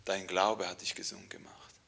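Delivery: soft whisper to the daughter; Jesus whispering his blessing to the healed woman. Acted. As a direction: breathe the words gently.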